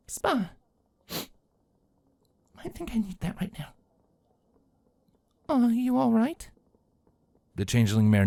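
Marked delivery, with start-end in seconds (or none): 0.1-3.7 much softer, tired; barely able to speak; quiet and weary. Acted. Sister after tears wanting the spa, very soft tired voice. 5.5-6.5 concerned. Acted. Concerned question, slow.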